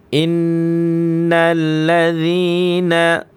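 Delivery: high, slow melodic tilawah chant; Quran recitation. Acted. Teacher chanting the verse for students. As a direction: draw the verse out in a long melodic line.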